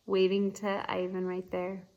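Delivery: usual speaking voice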